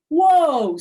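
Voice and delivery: loud, high whoa; a startled boy's whoa; surprised whoa. A narrator's reading. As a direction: kid stopping short in surprise. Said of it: "said Lenny".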